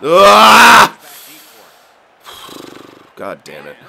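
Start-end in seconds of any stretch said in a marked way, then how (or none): 0.0-1.0 loud, higher cry of frustration; frustrated yell. Real, not acted. Gamer losing the puck again, frustrated loud cry. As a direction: let out a sharp loud ah.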